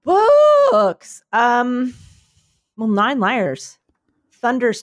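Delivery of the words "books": high, loud burst of excitement; excited shout. Real, not acted. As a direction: announce it with a big high cry. Excited announcement of books.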